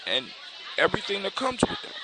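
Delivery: high, soft protest from the prince; understated shocked protest; shocked pleading. A narrator's reading. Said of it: "the prince looks shocked".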